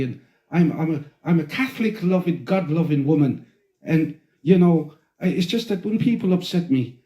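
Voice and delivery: pious Irish woman, put-on accent; Irish lilt, protesting her piety; Irish lady impression. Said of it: "of this Irish lady".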